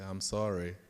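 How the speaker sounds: normal talking voice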